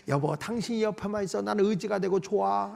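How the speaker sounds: soft, affectionate reassurance; acted tender wife. Wife telling her husband he is enough, softly tender. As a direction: speak gently, as to a beloved husband. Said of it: "이래야 되는데".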